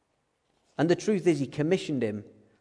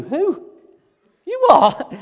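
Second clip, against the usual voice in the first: very high, louder squeak of disbelief; shocked Moses; 11 dB louder, much slower than usual. Retold or imitated. Moses stunned that God sends him, squeaking high.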